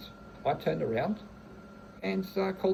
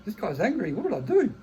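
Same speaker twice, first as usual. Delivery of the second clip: high, loud, panicky mock alarm; imitating someone suddenly scared of the angry guy; mock frightened; 7 semitones higher, 8 dB louder than usual. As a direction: play a startled bully backing off, hammed-up. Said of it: "'cause then people go".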